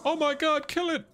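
high-pitched panicked yelp; alarmed. Real, not acted. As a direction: cry out in sudden horror at a creature. Startled by a talking game monster.

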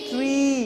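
"Tree" with a long, held ee; lengthened ee in "Tree"; drawn-out vowel. Shown as a demonstration. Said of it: "এইগুলো একটু লম্বা করে".

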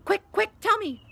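loud, high, agitated demand; urgent caretaker. A narrator's reading. Filch hurrying Peeves to tell, agitated demand. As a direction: press hard for an answer.

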